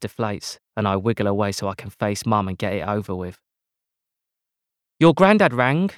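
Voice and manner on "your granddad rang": high, loud pointed stern mum. A narrator's reading. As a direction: press the point on granddad. Stern mum confronts son.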